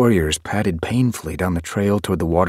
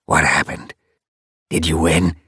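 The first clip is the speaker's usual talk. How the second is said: lightly voiced old tom, rasping out his question; a hint of a frail elder asking anxiously. A narrator's reading. Elder greets the returning leader, lightly rasping. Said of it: "one of them rasped".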